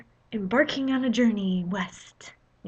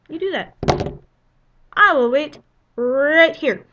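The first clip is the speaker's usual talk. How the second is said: defiant; 5 semitones higher, 6 dB louder than usual. Retold or imitated. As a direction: dare her to try. Defiant to clerk.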